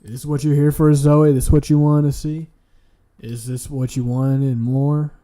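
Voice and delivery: loud mock taunt. Real, not acted. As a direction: shout it at her teasingly. Taunting friend Zoe loudly.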